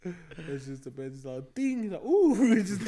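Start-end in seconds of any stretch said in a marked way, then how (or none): 2.0-2.3 high, loud comic "ooh"; startled ooh. Retold or imitated. Friend retelling the Simpsons car scene, startled high ooh. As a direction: whoop it up high in mock surprise. Voicing startled cartoon driver.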